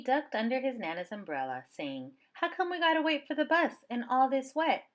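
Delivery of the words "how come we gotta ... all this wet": put-on higher, louder little boy complaining; whiny child. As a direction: play a kid grumbling about the rain. Child asks Nana a question.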